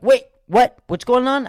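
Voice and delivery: loud, high double take; startled interest. Acted. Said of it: "And you'll be like".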